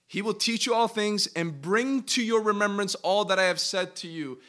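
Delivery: loud preaching voice, easing at the end; emphatic scripture reading. Real, not acted. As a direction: proclaim the verse with force. Reads scripture with preaching force.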